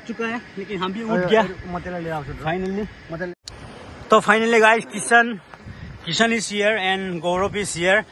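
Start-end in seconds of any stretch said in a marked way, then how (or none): none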